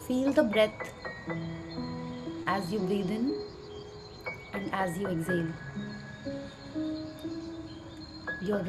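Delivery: soft, slow meditation voice; calmly walk them through gratitude; guided meditation. Acted.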